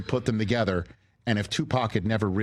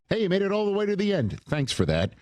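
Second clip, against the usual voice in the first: quick, bright host sign-off read, pitched up; upbeat show outro; 6 semitones higher than usual. Acted. Host thanking listeners and plugging the show, brisk promo read. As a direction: rattle off the plug like a radio promo.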